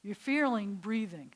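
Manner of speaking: ordinary talk, nothing marked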